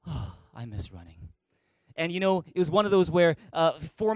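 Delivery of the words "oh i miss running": low, soft, self-pitying moan; mock-mournful lament; mopey sigh. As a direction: sulk it out like you miss it badly. Sighing over missed running.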